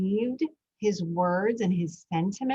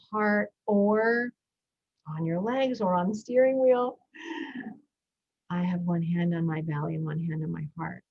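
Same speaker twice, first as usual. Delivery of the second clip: guided breathing. Acted. Podcast host guiding parents through breathing, in a calm meditation voice.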